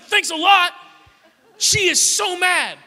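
put-on high, loud outburst of an aggrieved kid brother; furious. Little brother confronting the brother who abandoned him, imitated fury. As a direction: storm in and yell it, drawing out the complaint. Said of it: "furious and angry".

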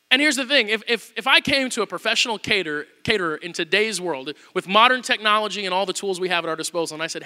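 normal talking voice

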